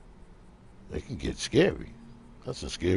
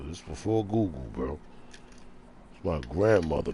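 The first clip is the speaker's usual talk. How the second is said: usual speaking voice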